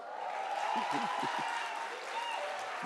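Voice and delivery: high breathy burst of laughter after her own boast; big laugh. Real, not acted. Laughing at her own brag to the audience, breathy laugh. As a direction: let out a breathy, squealing laugh.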